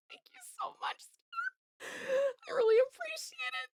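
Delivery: real tears caught in the throat; tearful thanks. Real, not acted. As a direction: choke up while thanking a generous viewer. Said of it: "I'm about to get emotional".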